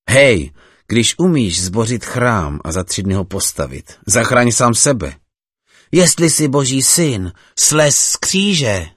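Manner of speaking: builds to a high, loud, slow jeer; mocking taunt. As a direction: taunt the man on the cross. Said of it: "posmívali se mu". (posměšné volání, stále výš a hlasitěji)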